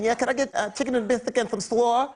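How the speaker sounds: high, lisping boxer impression; Mike Tyson. Boxer ordering fried chicken, Mike Tyson impression. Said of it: "Mike Tyson going through the drive-thru".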